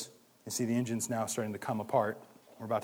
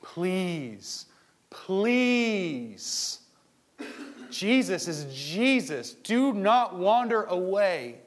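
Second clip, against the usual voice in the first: imitating a friend pleading, slow and high at the end; 5 semitones higher, 4 dB louder, much slower than usual. Pleading with a straying brother over coffee, slow and high. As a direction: gently beg a straying brother to come back. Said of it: "and you're pleading".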